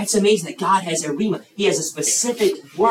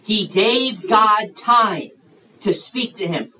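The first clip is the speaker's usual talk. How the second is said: "He gave God time" stretched out, higher and louder; emphatic key point; 5 semitones higher, 4 dB louder, much slower than usual. Real, not acted. Preacher to young listeners on a very important point, emphatic. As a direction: drive home "gave God time", each word drawn out. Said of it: "this is a very important point".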